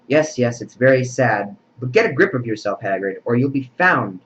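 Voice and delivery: usual speaking voice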